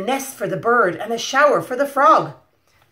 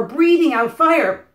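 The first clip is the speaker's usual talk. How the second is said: ordinary delivery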